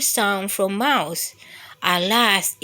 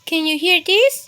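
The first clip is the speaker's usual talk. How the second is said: mouse into pillow; 9 semitones higher, 6 dB louder than usual. A narrator's reading. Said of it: "Mouse mumbled into his pillow".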